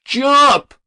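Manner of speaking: high, loud single cry; shout. Acted. Lone young voice in the crowd shouting up to the roof, loud high cry. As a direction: fling the word up at the roof. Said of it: "shouted a lone word to the boy".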